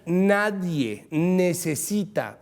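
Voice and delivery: "necesita" drawn out very slowly for weight; slow, deliberate emphasis on "necesita"; stress on necesita. Real, not acted. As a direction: stretch out "necesita" so the verb stands out. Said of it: "ojo al verbo".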